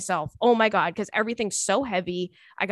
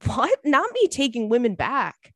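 ordinary talk

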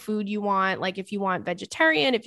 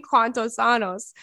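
repeated mock yell; 3 semitones higher, slower than usual.

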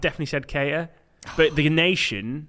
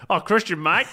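laughing mock-Australian accent; jokey Aussie twang on "Mate"; 5 semitones higher, 4 dB louder than usual. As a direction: play an Australian greeting him, amused. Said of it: "Australian League and they were like".

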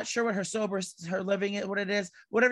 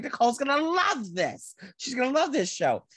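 higher, thrilled gushing about the show; bursting to tell her friend, holding back a scream; excited gush; 7 semitones higher than usual. Retold or imitated. Imagining her friend Nicole loving the show, excited gushing. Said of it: "wanting to scream".

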